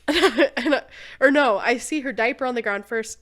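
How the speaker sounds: ordinary talk, nothing marked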